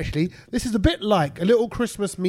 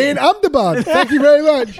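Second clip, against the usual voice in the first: mock Indian accent; 8 semitones higher, 6 dB louder than usual.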